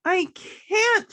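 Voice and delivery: high, loud angry cry; shouting. Acted. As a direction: shout back in defiance. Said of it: "shouted Amy".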